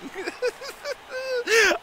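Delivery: long high-pitched laugh; high giggle. Real, not acted. Laughs at making James uncomfortable.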